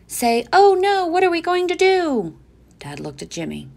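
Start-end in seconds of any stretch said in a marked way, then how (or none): 0.0-2.4 put-on a boy showing Dad how to sound scared; eager little boy prompting. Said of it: "Jimmy said to Dad".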